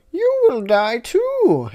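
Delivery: very high, slow Dumbledore threat; drawn out cartoon menace. Dumbledore threatening the Teletubbies, very high and slow. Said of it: "said Dumbledore".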